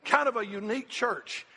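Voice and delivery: high-pitched, driving sermon delivery; impassioned preaching. Real, not acted. Preacher urging the church to want to learn, impassioned preaching. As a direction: preach it hard, stretching the big cries.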